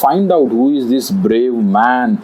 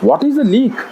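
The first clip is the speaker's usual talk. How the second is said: much higher-pitched wondering question; puzzled tiger; 12 semitones higher than usual. A narrator's reading. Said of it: "The tiger thought".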